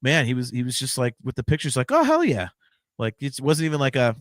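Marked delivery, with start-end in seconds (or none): 1.9-2.5 high, keen mock reply; eager yes. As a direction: toss off an enthusiastic yes like a happy rocker. Imitating the drummer's eager reply.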